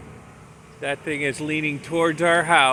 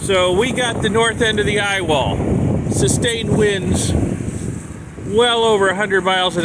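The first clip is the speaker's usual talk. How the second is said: raised voice over generator; 6 semitones higher than usual. Real, not acted. Morning after, generator noise.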